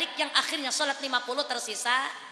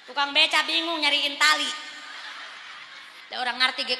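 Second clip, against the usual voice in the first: ordinary talk, nothing marked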